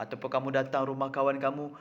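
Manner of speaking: ordinary talk, nothing marked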